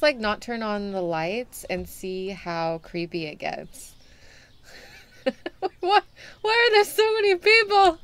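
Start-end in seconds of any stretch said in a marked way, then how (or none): 5.2-8.0 high, loud panicked question; overwhelmed cry. Real, not acted. Shopkeeper swamped by customers, high and panicked. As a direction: wail at the crowd.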